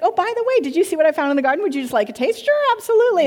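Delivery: high, quick sing-song offer; the woman lightly offering the fruit; mock casual offer. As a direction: play it as a breezy teasing invitation. Mock dialogue of woman and man.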